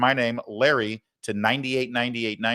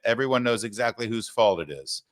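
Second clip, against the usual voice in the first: normal talking voice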